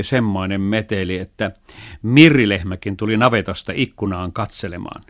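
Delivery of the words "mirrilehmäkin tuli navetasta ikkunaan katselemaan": plain reading voice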